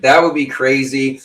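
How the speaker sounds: ordinary delivery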